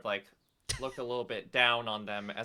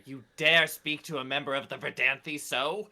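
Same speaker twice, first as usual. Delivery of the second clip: higher, louder imperious rebuke; haughty outrage; 4 semitones higher, 6 dB louder than usual. Acted. Esther rebukes men for their insolence, haughty. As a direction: rebuke an inferior with offended dignity.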